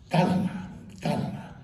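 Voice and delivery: hushed, soft plea for calm; mock soothing. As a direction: imitate a therapist softly settling someone down. Therapist calming a shouting father.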